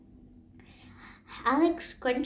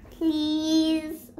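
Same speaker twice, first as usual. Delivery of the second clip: begging; 3 semitones higher than usual. Acted. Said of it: "Phoebe begged".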